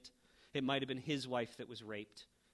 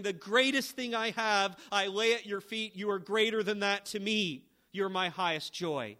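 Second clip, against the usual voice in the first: fervent prayer; 5 semitones higher, 9 dB louder than usual. Acted. Psalmist's prayer quoted while preaching.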